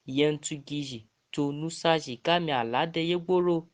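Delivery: normal talking voice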